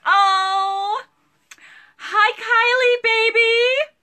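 high, slow, loud cooing; baby talk. Acted. Live seller greets a viewer's toddler in cooing baby talk. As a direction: coo at the child like a baby. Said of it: "talking like you're the baby".

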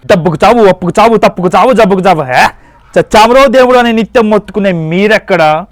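loud, grand mythological god of death; thundering Yama. Acted. Yama rebukes the human on his throne, loud booming declaration. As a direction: boom it like a stage-drama Yama rebuking a mortal.

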